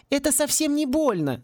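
put-on high reassuring girl's voice. A narrator's reading. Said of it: "заверила Мергиона". (высокий голос, успокаивающе)

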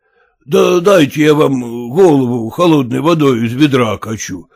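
ordinary delivery

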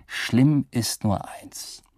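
plain reading voice

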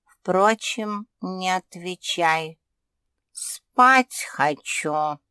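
slow, sleepy dismissal; lazy. A narrator's reading. British cat brushing off the pug, wanting sleep, lazily. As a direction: drag it out lazily, voice sinking. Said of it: "лениво осведомился британец".

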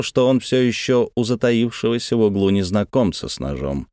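plain reading voice

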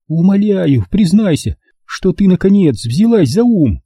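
high-pitched voice for an urgent mother; pleading mother. Acted. Mother begging her daughter to see sense, urgent.

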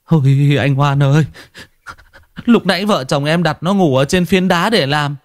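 high, pleading, stammering cry for help; distraught husband stammering to Hoan about his missing daughter; panicked stammering husband. A narrator's reading. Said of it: "Còn anh chồng thì lắp bắp".

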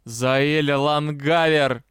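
slow, drawn-out growl of a name; roared summons. A narrator's reading. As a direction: roar it slowly like an angry father. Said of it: "как раздался рык".

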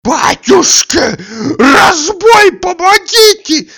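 very high, loud, panicked shriek; screaming for help. A narrator's reading. Tarantyev cries robbery, shrieking for help. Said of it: "кричал Тарантьев".

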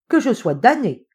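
exclaiming. A narrator's reading. Hopkins spotting his friend's oversight, exclaiming. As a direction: cry out as if struck by a realisation. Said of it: "s'écria Hopkins".

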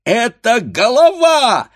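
very high, loud cry of warning; piercingly high shout; alarmed shouting. A narrator's reading. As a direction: yell a panicked warning to your mates. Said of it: "закричали хлопцы".

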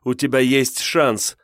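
strained appeal to a captor; speaking through pain. A narrator's reading. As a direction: push the words out through pain. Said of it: "сквозь боль проговорила Ольга".

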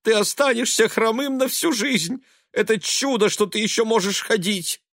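high-pitched fretting; horrified mother. A narrator's reading. Mother fearing son stays lame, horrified. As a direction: wail it in anxious disbelief.

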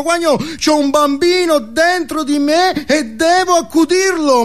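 high, rising shouted sermon; preaching guru. Acted. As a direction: build to a fervent shout like a guru. Guru preaching at full force. (predica esaltata, voce alta che cresce fino a gridare)

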